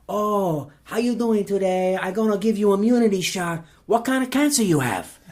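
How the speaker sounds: high-pitched chirpy Filipino nurse voice; Filipino accent. Retold or imitated. Nurse greeting a cancer patient, high Filipino accent. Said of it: "that's a very good Filipino accent".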